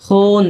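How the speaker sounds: হুন with a lengthened oo; long oo. Shown as a demonstration. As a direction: linger on the vowel in হুন. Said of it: "এরকম উচ্চারণ হচ্ছে".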